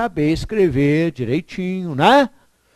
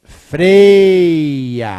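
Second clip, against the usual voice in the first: "Freia" loud and drawn out, falling; stressed answer; 9 dB louder than usual. Shown as a demonstration. Teacher to class, answering with a long loud freia. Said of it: "Ele o quê, gente?".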